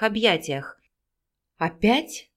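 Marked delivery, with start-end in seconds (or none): 1.6-2.3 a touch of hoarse voice; хриплый вопрос; hoarse question. A narrator's reading. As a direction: ask it roughly, eager. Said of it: "хрипло спросил Дмитрий".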